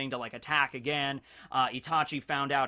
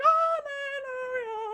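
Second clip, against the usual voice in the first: much higher, strained voice; overcome; much slower than usual. Real, not acted. Fan reacting to the sad scene, high choked voice.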